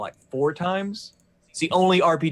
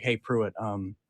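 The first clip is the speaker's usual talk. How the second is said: low, soft and serious; heavy, grave friend voice; 5 semitones lower, 6 dB quieter than usual. Retold or imitated. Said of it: "the gravity in his voice".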